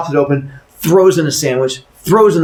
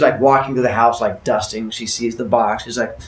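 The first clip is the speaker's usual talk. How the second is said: ordinary delivery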